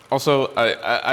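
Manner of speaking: normal talking voice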